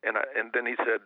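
ordinary talk, nothing marked

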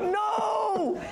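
high, drawn-out wail of "no"; comic exasperated cry; irritated. Retold or imitated. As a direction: protest it high and long. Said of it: "this time I'm so irritated".

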